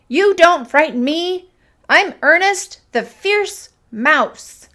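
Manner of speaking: high, loud, bold declaration; shouting. A narrator's reading. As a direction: shout defiance like a fierce little mouse. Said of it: "he shouted".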